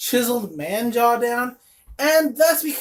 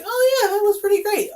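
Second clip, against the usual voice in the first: impressed gush; 11 semitones higher than usual. Retold or imitated. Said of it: "artistry that I'm like".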